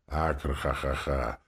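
slow, low demonic guffaw; evil cackle. A narrator's reading. Demon gloating over her victim, evil cackle. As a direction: laugh like a gloating demon, drawn out.

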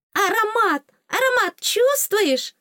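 very high, loud little fairy voice; excited fairy. A narrator's reading. Fairy urges heroine to smell, excited squeal. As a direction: squeal it eagerly, drawing out the question. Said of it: "Фея возбуждённо вертелась рядом".

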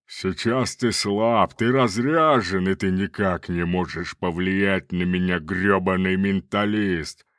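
slow, booming sneering demon. Acted. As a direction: gloat over a weakened foe. Demon taunts the mentalist.

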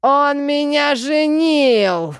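loud, high, drawn-out bellow; outraged roar. A narrator's reading. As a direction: yell the complaint in fury, stretching it. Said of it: "рявкнул Тей". (яростно рявкнуть)

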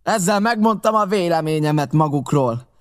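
enraged pirate threatening the blockhouse; angry threat. A narrator's reading. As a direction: hurl it at the enemy. Pirate's furious parting threat.

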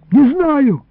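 high, pleading denial; frightened plea. A narrator's reading. Boy questioned by his captor, frightened plea. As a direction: whine it high in fear. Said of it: "взмолился я дрожащим голосом".

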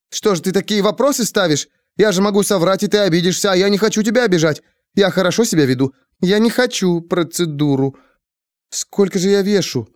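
high, anxious, hurried pleading; fretful Annette. Acted. Annette paces and frets about lying, high and anxious. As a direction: fuss and plead in a rush. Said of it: "заметалась по комнате".

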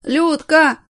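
high loud yell of the name; frantic yell. Acted. Drunk man howling for his wife, high and loud. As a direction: howl the name out. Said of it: "издал истошный вопль".

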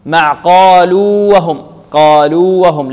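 loud, slow قالوا وهم with long oo before waw; unmerged قالوا وهم; clear separation. Shown as a demonstration. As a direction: hold قالوا out, then say وهم. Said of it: "يعني أظهر".

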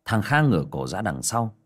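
usual speaking voice

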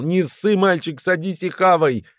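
high-pitched bark inside a joke. A narrator's reading. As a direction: bark it as the gang boss. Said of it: "рявкнул".

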